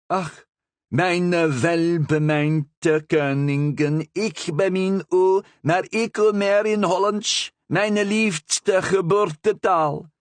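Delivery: high, slow, loud bird voice; parrot speaking Dutch. Acted. Parrot declaring love to the Queen in Dutch, high slow bird voice. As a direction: croon the Dutch slowly like a parrot.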